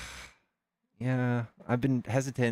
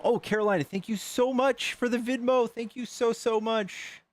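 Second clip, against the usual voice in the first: much higher, delighted thank-you; gushing thanks; 9 semitones higher than usual. Real, not acted. Streamer thanking Carolina for a Venmo, gushing. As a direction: squeal your gratitude.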